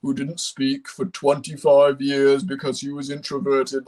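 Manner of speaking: slow, drawn-out gentle giant voice; Big Mickey. Acted. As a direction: speak as a reserved gentle giant, unhurried. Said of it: "kind of this quiet, gentle giant".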